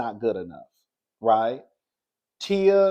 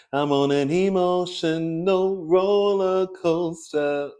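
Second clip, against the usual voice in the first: mock dramatic whine; 3 semitones higher, slower than usual. Mocking Tia's complaint.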